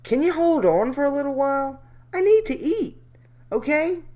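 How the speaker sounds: mocking predator. Re-quoting predator higher.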